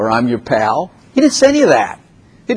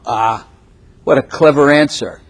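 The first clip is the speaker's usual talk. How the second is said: ordinary talk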